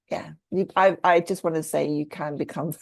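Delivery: ordinary talk, nothing marked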